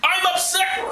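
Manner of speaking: deadpan sarcastic wail; mock grieving Reuben. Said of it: "Reuben tore his clothes in grief".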